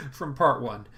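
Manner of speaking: laughing it out; laughter over the words. Real, not acted. Laughing at his own failed flights.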